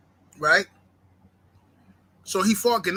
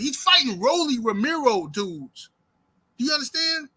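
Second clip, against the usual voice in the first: ordinary talk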